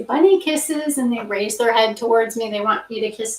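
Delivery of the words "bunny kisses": high, sing-song call to the bunnies; cooing call. Real, not acted. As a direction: coo it up high to the pets. Calling her rabbits for kisses.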